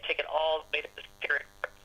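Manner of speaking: usual speaking voice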